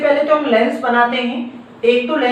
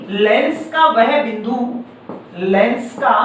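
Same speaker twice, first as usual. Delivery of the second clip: slow, chunked phrases with long pauses; slow dictation; slower than usual. Shown as a demonstration. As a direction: dictate it slowly so students can write. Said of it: "तो अब हम इसको परिभाषा में क्या लिख सकते हैं".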